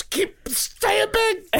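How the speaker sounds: mock slow voice. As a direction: stretch it out teasingly. Jokey slow high aside.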